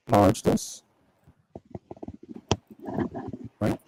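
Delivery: glitchy, degraded digital audio; robotic mic. Real, not acted. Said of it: "sounds a little, um, robotic".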